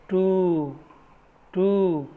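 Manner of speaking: टू with a long stretched oo; long oo demo. Shown as a demonstration. Teacher models टू for students, long oo. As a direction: say टू holding the oo long. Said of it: "long ऊ sound".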